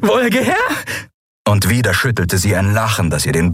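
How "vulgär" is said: high, strained, halting voice; broken, high-pitched mocking with gaps between words; pained taunt. A narrator's reading. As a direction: taunt your tormentor through agony, word by word. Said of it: "Unter Qualen hob sie den Kopf".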